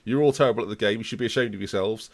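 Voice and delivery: low, quiet dismissive verdict; mock ridicule. Streamer mocking fans' tactics in a low dismissive drone. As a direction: drone it like a bored judge. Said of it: "I'm just gonna go".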